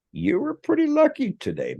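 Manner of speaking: high, sing-song tease; teasing. Retold or imitated. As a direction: tease him with a smirk. Friend teasing with smirk.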